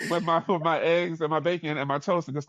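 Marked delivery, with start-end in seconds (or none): none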